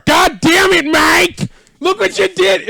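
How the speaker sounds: high, loud mock outrage; exasperated shout. Real, not acted. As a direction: yell at him in fake fury. Mock outburst at Mike.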